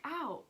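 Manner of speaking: high, loud retold "ow"; pained ow. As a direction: act out a sudden yelp of pain. Retells being hurt in exam.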